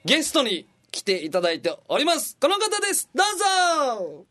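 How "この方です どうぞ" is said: 高い声で勢いよくゲストを紹介; 「どうぞ」を伸ばして登場を煽る; ゲストの呼び込み. Pretend. ゲストを呼び込む. (高らかなゲスト呼び込み)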